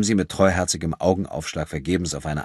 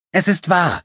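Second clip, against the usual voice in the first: put-on high, loud girl's insistence; insistent; 13 semitones higher, 7 dB louder than usual. A narrator's reading. Thief defending her story to doubters, insistent. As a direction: hold your ground, pitched right up. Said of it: "beharrte Corinna".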